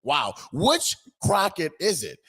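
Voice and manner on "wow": ordinary talk, nothing marked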